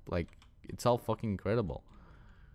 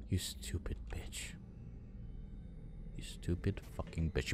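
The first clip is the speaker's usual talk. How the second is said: whispered insult; 3 semitones lower, 12 dB quieter than usual. Real, not acted. Cursing his own soldier.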